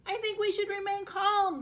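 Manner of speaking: high, loud, ironic yell; yelled calm. A narrator's reading. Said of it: "Goose yelled".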